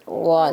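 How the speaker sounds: غاد said from the side of the tongue; model sound. Shown as a demonstration. Teacher shows the student غاد, carefully. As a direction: model غاد using the back side teeth. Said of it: "غاد زبان کی side لگائیں".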